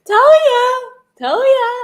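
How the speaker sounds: very high, loud triumphant squeal; gleeful gloating. Real, not acted. Streamer gloats as partner takes it back, squealing. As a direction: crow it in a high-pitched yell.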